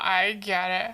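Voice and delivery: ordinary delivery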